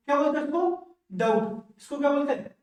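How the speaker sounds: normal talking voice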